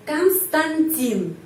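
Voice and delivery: Konstantin said slowly, stress on the final i, first o reduced to a; slow model of Konstantin with stress on the last syllable; slow name demo. Shown as a demonstration. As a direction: stretch out Konstantin for the class. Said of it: "di sini penekanannya pada huruf I".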